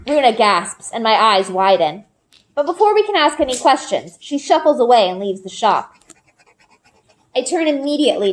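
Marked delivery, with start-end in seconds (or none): none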